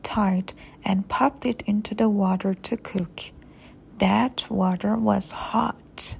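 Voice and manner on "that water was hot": ordinary delivery, nothing marked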